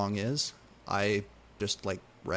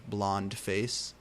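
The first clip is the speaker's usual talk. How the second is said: plain reading voice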